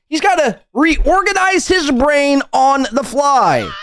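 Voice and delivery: high, drawn-out mock wail. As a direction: wail it slowly, making fun of his outburst. Mocking the streamer's shouted rant.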